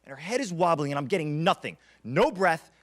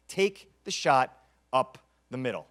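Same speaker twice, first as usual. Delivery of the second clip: usual speaking voice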